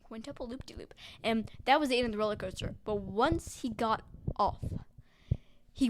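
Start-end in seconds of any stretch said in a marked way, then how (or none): 0.0-0.9 whispered. Pretend. Repeating word in whisper.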